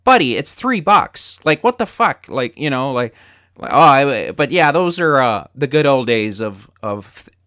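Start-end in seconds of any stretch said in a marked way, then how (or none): 0.0-2.3 exasperated attendant. Retold or imitated. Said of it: "the look on his face going".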